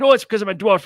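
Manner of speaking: high, loud raging little man in Scottish voice; comic dwarf in a furious high-pitched huff. Retold or imitated. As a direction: squeak it out in a huffy Scottish rage. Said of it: "flew into his rage again".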